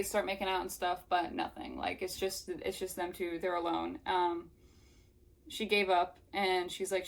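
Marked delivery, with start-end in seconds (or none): none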